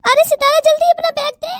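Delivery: high, panicky plea to hurry; panicked urging. Acted. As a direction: beg her friend to hand it over fast. Friend panicking under threat. (घबराई हुई जल्दी)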